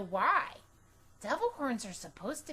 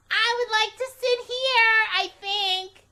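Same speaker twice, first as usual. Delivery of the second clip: put-on very high, loud little girl; bossy and sure of herself; Junie B voice; 11 semitones higher, 9 dB louder than usual. A narrator's reading. Girl claiming the red chair, high and loud. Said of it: "I told her".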